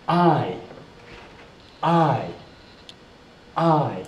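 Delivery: "I" drawn out as eye, each falling; long i sound. Shown as a demonstration. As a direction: stretch each "I" like the vowel in pine. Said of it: "long sound".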